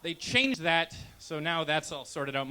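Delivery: ordinary delivery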